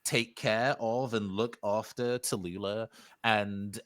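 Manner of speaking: ordinary talk, nothing marked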